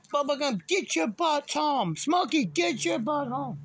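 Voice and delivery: high-pitched, stretched-out holler; yelling for dogs. Retold or imitated. Owner tells cop what he yelled, high hollering. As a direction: call the dogs home in long high yells. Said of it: "All I said is".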